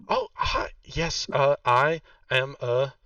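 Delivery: sudden high-pitched nervous greeting; high squeaky greeting. Acted. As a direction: squeak out a flustered hi. Guard NPC voiced in scene.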